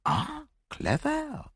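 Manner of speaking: high, slow murmur of admiration; appreciative murmur. A narrator's reading. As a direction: savour it slowly, admiringly. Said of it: "murmured Poirot appreciatively".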